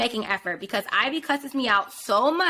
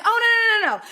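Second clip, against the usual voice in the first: high, loud imitation of a flustered date; mock hasty protest; 12 semitones higher, 5 dB louder, faster than usual. Date brushing off her back excuse, mock hasty protest. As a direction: rattle off the noes, pitched way up.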